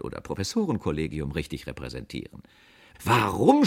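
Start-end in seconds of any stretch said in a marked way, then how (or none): 3.0-3.7 loud, higher, affronted question; indignant yell; offended shout. A narrator's reading. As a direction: cry out, insulted. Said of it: "schrie ich beleidigt".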